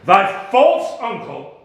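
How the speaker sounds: loud indignant father; sharp rebuke. Acted. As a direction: snap her back to attention. Prospero chides inattentive Miranda.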